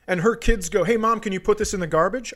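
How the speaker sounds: ordinary talk, nothing marked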